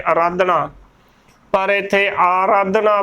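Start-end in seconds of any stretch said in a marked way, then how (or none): 0.1-0.7 ਆਰਾਧਨਾ with the first vowel cut to a short "a"; common mispronunciation. Shown as a demonstration. Teacher showing the congregation's usual slip, mispronunciation. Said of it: "ਸੰਗਤਾਂ ਜ਼ਿਆਦਾਤਰ ਆਰਾਧਨਾ ਬੋਲ ਦਿੰਦੇ ਨੇ". 2.2-3.0 ਆਰਾਧਨਾ opening on a full long "aa"; correct pronunciation. Shown as a demonstration. Teacher correcting the congregation's reading, careful pronunciation. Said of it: "ਐੜੇ ਨੂੰ ਜੋ ਕੰਨਾ ਹੈ".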